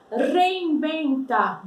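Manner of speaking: "Reinventa" slowly, rolled R and V said as B; Spanish pronunciation, slow. Shown as a demonstration. Teacher modeling Spanish "Reinventa", rolled R and B. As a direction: say "Reinventa" with trilled R and B sound. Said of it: "forcei o R no começo".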